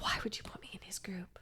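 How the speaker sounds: unvoiced whisper. Telling listener her teen thought, whispered. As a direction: hiss it under your breath.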